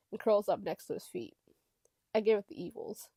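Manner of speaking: ordinary delivery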